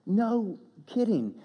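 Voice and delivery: slow, high-pitched astonishment; retold amazement. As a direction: draw it out high, imitating his disbelief. Retelling biker's amazed agreement.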